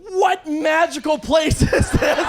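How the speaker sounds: slow, delighted high exclamation, laughing through it; amazed. Retold or imitated. Comic to bartender over a free drink, awed and laughing.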